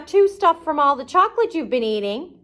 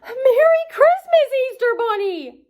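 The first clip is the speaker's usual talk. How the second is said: excited cry; 10 semitones higher than usual. Acted. Patrick greeting SpongeBob in costume, high delighted cry. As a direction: greet the bunny like it's Christmas morning. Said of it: "Patrick looked outside and cried".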